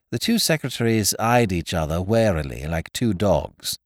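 ordinary delivery, nothing marked

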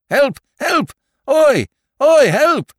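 very high, loud shouts; shouting for help. A narrator's reading. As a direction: bellow for help together. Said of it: "both shouted together".

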